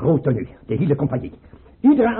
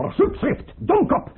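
outburst; slower than usual. Acted. Tsar explodes at chamberlain.

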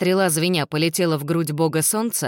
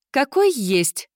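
sharp, high retort; snapping back; 9 semitones higher, much slower than usual. Acted. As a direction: bite back at him. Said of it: "огрызнулась мисс Мэри".

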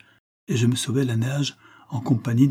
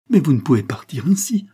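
urgent plea; 6 semitones higher, 4 dB louder than usual. Acted. Concepcion pleads he must not go.

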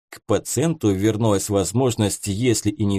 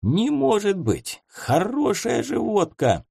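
horrified; 8 semitones higher than usual. A narrator's reading. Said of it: "с ужасом взирал на бутылку".

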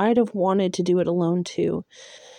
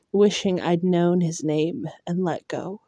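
plain reading voice

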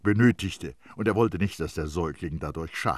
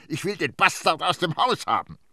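heated demand; 11 semitones higher, 5 dB louder than usual. A narrator's reading. Nurse demands the baby gone.

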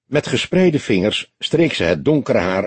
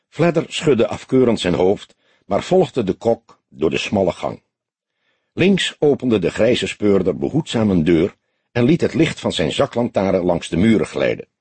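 ordinary delivery

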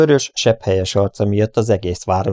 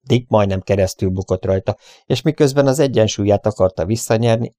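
plain reading voice

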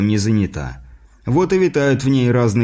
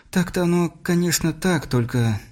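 higher-pitched, hesitant mother's voice; 6 semitones higher, 4 dB quieter than usual. A narrator's reading. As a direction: trail off in high-pitched doubtful agreement. Mother hesitantly half agrees with father. (неуверенный женский голос, повыше)

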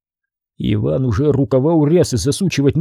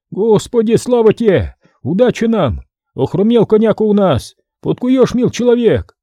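lightly voiced old traveller, higher pitched; old man's voice; 8 semitones higher than usual. A narrator's reading. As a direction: plead with the smith as a relieved old man. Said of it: "Старческий голос".

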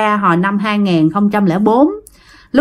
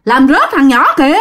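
raised, higher-pitched retold warning; alarmed calling out; 6 semitones higher than usual. Motorbike driver calling to the father, alarmed retold shout. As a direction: call after someone in mild mock alarm. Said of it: "Ổng mới kêu".

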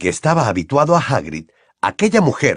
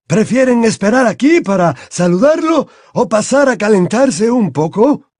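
a raised, courteous headmaster; Dumbledore voiced; 10 semitones higher, 4 dB louder than usual.

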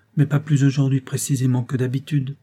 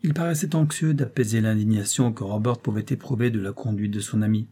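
ordinary delivery

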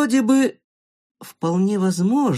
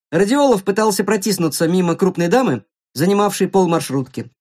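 ordinary delivery

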